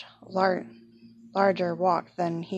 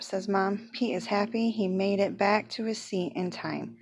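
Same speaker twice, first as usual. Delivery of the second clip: plain reading voice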